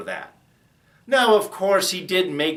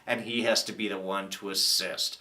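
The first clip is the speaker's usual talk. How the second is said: ordinary talk, nothing marked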